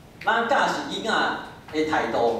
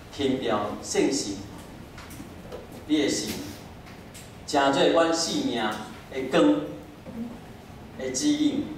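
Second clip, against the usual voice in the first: prayer; 6 semitones lower, 4 dB quieter than usual. Acted. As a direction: pray softly and reverently. Leads closing prayer.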